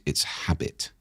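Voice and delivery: hushed whisper. Real, not acted. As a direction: drop to a whisper. Aside after the Aristotle quote.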